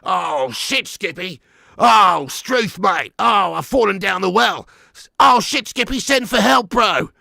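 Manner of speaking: hammed-up Australian outback cry for help; Big Ange impression. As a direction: panic down a well as a mock Australian. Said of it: "what would Big Ange be saying now?".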